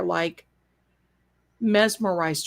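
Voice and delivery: plain reading voice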